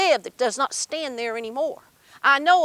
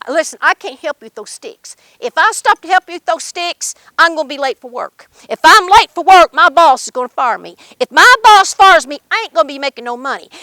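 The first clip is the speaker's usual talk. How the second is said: louder and louder, panicking; building exasperation; 10 dB louder than usual. Acted. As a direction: rant faster about getting fired. Brother refuses, rising panic.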